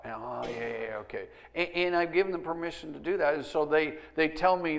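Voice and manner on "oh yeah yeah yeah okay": soft, low mock concession; retold concession. Dad taking his kids' correction, retold soft concession. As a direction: play a dad quietly giving in.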